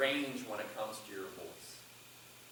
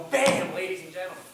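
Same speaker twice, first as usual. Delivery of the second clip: explosive high "bam" into the address; sudden big, raised delivery; range jumping up; 4 semitones higher, 10 dB louder than usual. Shown as a demonstration. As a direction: burst out loud like an announcer. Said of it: "I brought the range up pretty quick".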